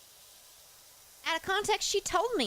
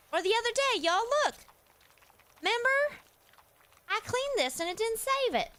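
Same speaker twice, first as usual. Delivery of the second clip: high-pitched, upset disbelief; dismayed disbelief; 8 semitones higher than usual. Real, not acted. Streamer shows viewers the lost progress, dismayed disbelief. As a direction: protest in a high voice at lost work.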